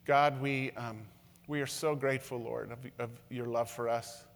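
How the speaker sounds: pastor praying gently over the room; prayer. Acted. Pastor leads closing prayer.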